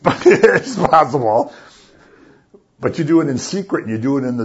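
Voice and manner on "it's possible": real amused laugh mid-sentence. Real, not acted. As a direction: laugh breaking into the words. Breaks into laughter at own aside.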